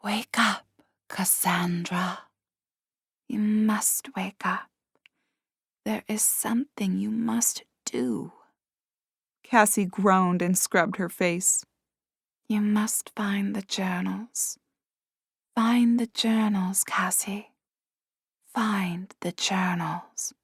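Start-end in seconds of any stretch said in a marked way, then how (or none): 0.0-8.3 slow, eerie whisper; haunt her awake softly; ghostly whisper. A narrator's reading. Ghost wakes sleeping girl. 12.5-20.3 slow, eerie whisper; haunt her awake softly; ghostly whisper. A narrator's reading. Ghost gives its command.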